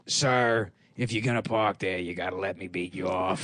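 low, official cop voice. Acted. Meter cop stopping someone parking, low mock-official voice. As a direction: drop well down into a policeman addressing a driver. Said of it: "it's a cop that's like".

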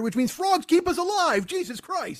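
high-pitched mock astonishment; amazed realization. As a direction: blurt it as a mind-blown viewer. Astonished viewer of nature special.

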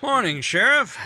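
forced cheerful greeting to the sheriff; hearty greeting. Acted. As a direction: play the innocent neighbour. Greeting sheriff at crime scene.

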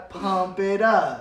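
slow flat monotone. Retold or imitated. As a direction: drag it out flat. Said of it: "in the most monotone voice".

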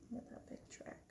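hushed aside, barely voiced; whisper. Real, not acted. Parent whispering to child over the book.

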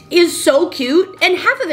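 slow, high, gushing. Real, not acted. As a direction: gush over it. Gushing about her apartment.

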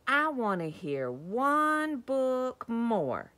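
loud, high, drawn-out demand; dinosaur shout. A narrator's reading. Reader voices dinosaur demanding a book, loud drawn-out shout. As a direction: shout it slowly like a stubborn child. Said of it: "and shout".

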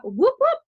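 high, loud whoop-whoop siren; siren imitation. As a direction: mimic a police siren.

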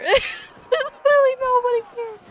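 high, loud laughing squeal; words squeezed out through crying laughter; laughing to tears. Real, not acted. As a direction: crack up helplessly mid-sentence. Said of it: "I'm crying".

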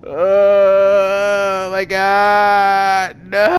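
dread. Real, not acted. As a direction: drag it out in disbelief. Drawn-out dread.

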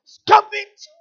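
sudden loud, high shout; shouted rebuke. Real, not acted. Preacher rebuking complaining pastors, shouted rebuke.

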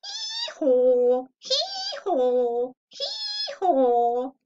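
loud hee-haw rising like a bray; donkey bray for the kids. Shown as a demonstration. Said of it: "How does the donkey say?".

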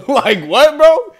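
high, loud incredulous laugh-talk; laughing disbelief. Real, not acted. Reacting to the church line with loud laughing disbelief. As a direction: crack up in disbelief.

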